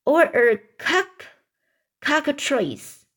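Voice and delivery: high, slow storybook yell; mild yell. A narrator's reading. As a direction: call the word out high and drawn out. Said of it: "yelled the tr".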